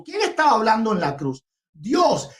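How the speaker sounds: normal talking voice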